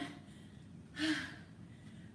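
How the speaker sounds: long breathless sigh after the set; tired sigh. Real, not acted. Trainer catching breath after the set, heavy sigh. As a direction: let the breath out, spent.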